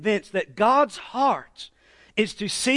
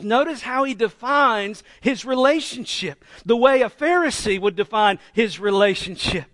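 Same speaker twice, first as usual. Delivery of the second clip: ordinary talk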